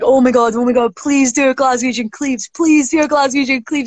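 mildly hammed-up begging; imitated pleading. Agency head begging for a Glaswegian Cleaves, imitated pleading.

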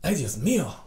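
put-on Spanish exclamation in a Mexican accent; mock Mexican Spanish, startled. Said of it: "That's your Mexican accent".

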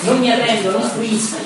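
high, loud cry of defiance; defiant outburst. Acted. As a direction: refuse to surrender, voice pitched right up. Acts Capaneo's defiant refusal. (sfida gridata, acuta)

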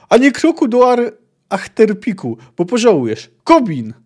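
high, loud threat ending in a call; threaten, then summon the big man. Acted. A sailor threatens the captain and calls Kobin, shouting.

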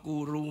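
"กูรู" with long oo vowels; mock "กูรู" as drawn-out koo-roo; mistaken Thai rendering. Lecturer complaining about ugly new borrowing, long-vowelled "กูรู". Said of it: "คนไทยก็ออกกูรู".

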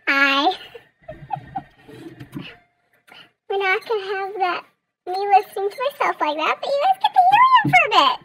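squeaky chipmunk voice with laughs; helium voice. Real, not acted. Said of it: "So I'm all squeaky".

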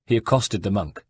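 ordinary delivery

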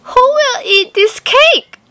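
high loud hen question; hen asking. Acted. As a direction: tempt them as the hen. Hen asks the animals.

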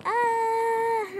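high, loud delighted shriek; a burst of happy screaming; excited squeal. Real, not acted. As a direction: squeal with real joy. Excited squeal over gift.

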